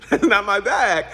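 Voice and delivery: laughing it out. Real, not acted. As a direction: say it through a laugh. Laughs, then repeats line louder.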